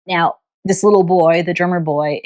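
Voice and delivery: ordinary talk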